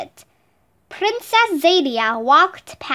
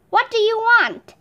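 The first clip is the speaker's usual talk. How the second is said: higher-pitched genie's question, lightly voiced; a touch of imperious genie, pitched up; 7 semitones higher than usual. A narrator's reading. Genie of the ring asks Aladdin, lightly imperious. Genie addresses his summoner.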